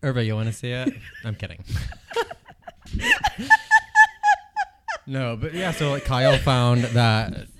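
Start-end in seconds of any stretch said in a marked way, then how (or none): 1.7-4.6 very high loud cackle; real high laughing shriek. Real, not acted. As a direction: burst into a shrill cackle.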